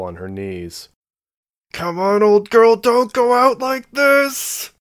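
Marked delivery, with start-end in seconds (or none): 1.7-4.7 high, strained urging through clenched teeth; will the machine on, high and intense; hissed through clenched teeth. A narrator's reading. Pilot urges her stricken mech up, straining through clenched teeth. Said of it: "she hissed through clenched teeth".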